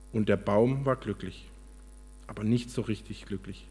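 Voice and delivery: lively, measured storybook reading; expressive storyteller with lifted key words; story narration. A narrator's reading. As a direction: read it aloud like a parable, with pauses. Reads a story aloud.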